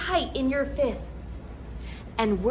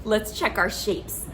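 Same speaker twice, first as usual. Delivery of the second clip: ordinary talk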